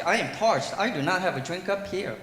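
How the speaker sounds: ordinary talk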